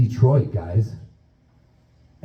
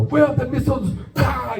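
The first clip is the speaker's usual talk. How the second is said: higher mock Russian shout of a thug; 9 semitones higher than usual. Russian captor yelling at the tied-up friend, mock Russian shout. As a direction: yell it like a cartoon Russian interrogator. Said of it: "like, a Russian somewhere".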